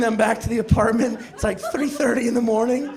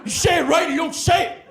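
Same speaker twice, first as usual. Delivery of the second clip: high, indignant mock tirade; exasperated high-voiced telling-off; outraged roommate; 7 semitones higher than usual. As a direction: scold a prankster, voice pitched way up. Acted roommate snapping at prank.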